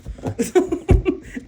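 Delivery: a high airy laugh bursting out; cracking up at the grocery story; high burst of laughter. Real, not acted. Laughs at her own story.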